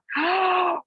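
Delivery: high, sudden gasp; bubble-in-the-mouth gasp. Shown as a demonstration. Teacher stopping the laughter, high sharp gasp. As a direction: gasp big to stop laughing. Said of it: "put a bubble in your mouth".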